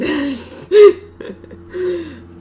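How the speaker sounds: high burst of laughter; loud laugh. Real, not acted. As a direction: crack up laughing. Loud laugh at profile picture.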